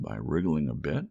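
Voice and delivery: ordinary delivery, nothing marked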